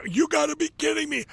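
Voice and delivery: disbelief. Retold or imitated. Retold reaction to the win.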